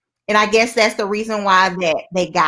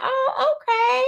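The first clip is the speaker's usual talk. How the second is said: surprised high voice; 14 semitones higher, 3 dB quieter, slower than usual. Retold or imitated. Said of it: "I was like".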